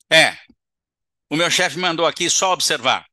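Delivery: loud, irritated justification; angry spirit. Acted. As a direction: grumble it out defiantly.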